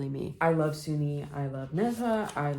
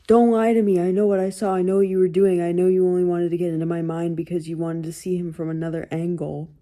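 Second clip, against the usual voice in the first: reading an angry accusation; 7 dB louder, faster than usual. Retold or imitated. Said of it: "He flinched".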